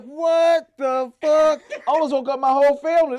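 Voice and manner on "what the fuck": loud, high, drawn-out mock yell; shocked yell. Recalling the porn scene, drawn-out shocked yell. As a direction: shout it slow in disbelief. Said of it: "I was like".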